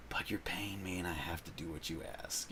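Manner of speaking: hushed, grudging whisper; a resigned whispered confession; whispered aside. Retold or imitated. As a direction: breathe it out like a secret grumble. Grudging inner thought quoted.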